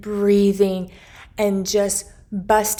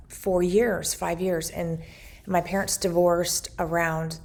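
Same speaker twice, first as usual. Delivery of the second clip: ordinary talk, nothing marked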